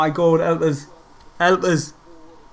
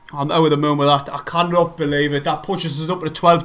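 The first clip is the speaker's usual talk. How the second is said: normal talking voice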